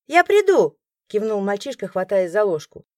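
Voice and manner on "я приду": put-on high, loud eager boy; eager child. A narrator's reading. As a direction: promise it brightly.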